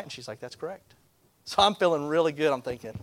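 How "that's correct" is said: very soft, matter-of-fact confirmation; quiet clerk. Retold or imitated. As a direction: confirm it under your breath, as the clerk. Clerk confirms quietly while typing.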